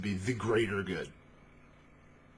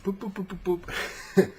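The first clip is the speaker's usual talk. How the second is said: high-pitched "boop" noises; string of silly high boops; playful booping; 11 semitones higher, 5 dB louder than usual. Acted. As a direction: boop along in a high little voice. Voicing the party going along.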